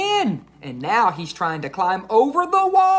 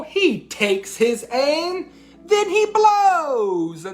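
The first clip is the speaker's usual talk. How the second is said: slow, rising higher and louder; building to a blow; 9 semitones higher, 4 dB louder, slower than usual. A narrator's reading. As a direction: stretch it out then blow.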